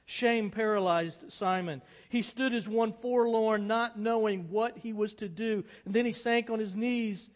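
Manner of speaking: loud, high, intense storytelling; dramatic story narration. A narrator's reading. Pastor reads a novel to the congregation, dramatic narration. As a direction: read it out with rising drama.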